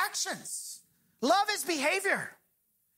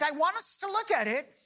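ordinary talk

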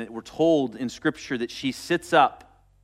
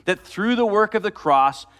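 louder preaching at full force; 4 semitones higher, 4 dB louder than usual. Acted. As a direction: drive it home to the congregation.

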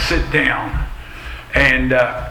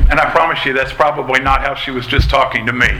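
ordinary talk

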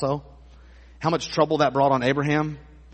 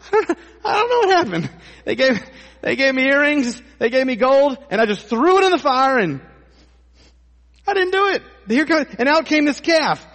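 much higher, louder, laughing whiny excuse voice; 12 semitones higher, 7 dB louder than usual. Retold or imitated. Preacher mocks Aaron excusing himself to Moses, whiny. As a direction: play a guilty man pleading innocence.

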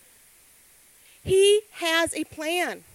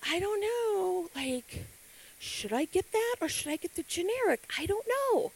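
dithering adult; 4 semitones higher, 6 dB quieter than usual. Retold or imitated. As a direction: mull it over hesitantly. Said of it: "And we're like".

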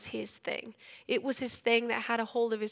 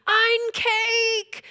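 put-on high, loud toddler outburst; excited toddler Stuart; 12 semitones higher, 10 dB louder than usual. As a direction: burst out like a little kid thrilled by cake. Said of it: "Stuart goes".

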